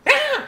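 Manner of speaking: short high-pitched yelp; tiny squeal from behind a door; overheard cry. Acted. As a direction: let out a sudden squeaky "ah". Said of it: "and you can hear".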